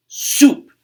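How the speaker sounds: high loud snapped word; snarled. Acted. The varmint snarls at Buddy, loud and high. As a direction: bite the word off at him. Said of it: "snarled the varmint".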